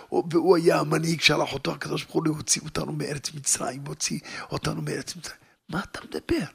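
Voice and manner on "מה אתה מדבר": whispered "מה אתה מדבר?" with a rising end; whispered retort. Retold or imitated. Wife whispering to her husband in disbelief. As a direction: hush it as a disbelieving question.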